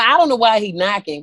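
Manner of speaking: ordinary talk, nothing marked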